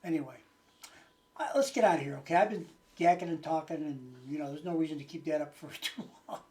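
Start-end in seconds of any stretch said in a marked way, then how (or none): none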